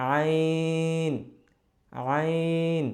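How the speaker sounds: "আইন" drawn very long with pressure mid-throat; pressed throat Ayn. Shown as a demonstration. Teacher showing the throat pressure, long pressed "আইন". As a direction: press from the middle of the throat, holding "আইন" level. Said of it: "চাপ দিয়ে আর কি".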